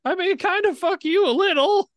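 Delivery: squeaky high little voice; the shrunken Minotaur pipes up; tiny squeak. Acted.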